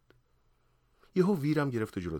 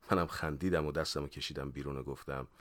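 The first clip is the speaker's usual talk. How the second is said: ordinary delivery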